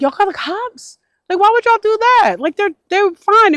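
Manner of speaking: retelling a run-in with a lady, high exasperated pitch; exasperated outburst. Retold or imitated. Retold confrontation with lady.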